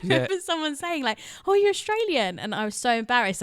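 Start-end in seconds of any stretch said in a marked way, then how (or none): 1.5-2.4 high, louder mock surprise; delighted discovery. Party guest mistaking the princess's muddled accent, surprised. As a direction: imitate a guest's exclaimed realisation.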